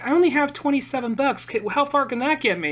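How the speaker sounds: lightly voiced child asking a favor; imitated hopeful kid. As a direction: play a kid short on cash. Voicing kid pleading at ticket counter.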